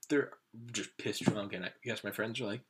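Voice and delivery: ordinary delivery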